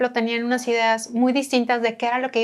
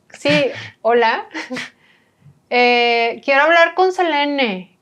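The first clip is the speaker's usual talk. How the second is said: put-on Monterrey accent; 2 semitones higher, 4 dB louder, much slower than usual. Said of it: "y el acento de Bernie".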